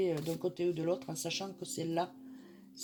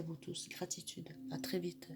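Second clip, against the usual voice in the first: soft whispered goodbye; whispering; 7 dB quieter than usual. Real, not acted. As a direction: whisper it close to the mic. Whispered farewell.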